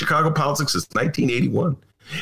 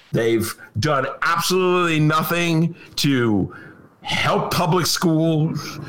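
normal talking voice

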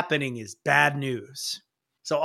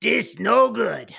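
put-on slow, higher old goblin voice; Old Knob Jinkies; 6 semitones higher, slower than usual. Goblin elder warning the others, slow. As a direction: warn them in slow broken speech. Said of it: "and he goes".

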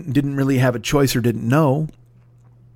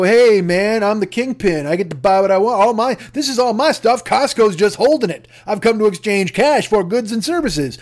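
higher, louder, pompous swagger; mock swaggering kingpin; 6 semitones higher, 3 dB louder than usual. Slob customer bragging to Costco, pompous hammed-up swagger. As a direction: strut like you own the store. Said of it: "swing your corpulence around and go".

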